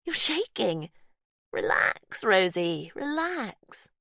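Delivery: high, unsettling sweet captor; Faith's voice. Acted. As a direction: coo to the victim, falsely kind. Faith soothing victim.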